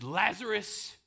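loud, high command; Jesus ordering Lazarus from the tomb; commanding call. Acted. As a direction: order the dead man out. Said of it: "Jesus says".

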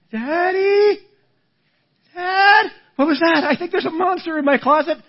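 put-on high, frightened little kid voice; scared child. Child calling dad at night, high and frightened. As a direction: call out like a child afraid of the closet.

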